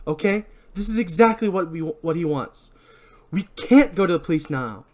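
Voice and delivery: high, urgent panicked pleading; frightened friend begging the others to see the danger; frantic warning. Acted. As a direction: plead with them, rattled and scared. Frantic character warns about killer.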